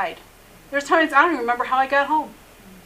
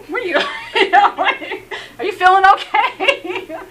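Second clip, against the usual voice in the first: high, loud, laughing question; mock their baffled concern through laughter; laughing mock disbelief; 9 semitones higher, 7 dB louder than usual. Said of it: "they're like".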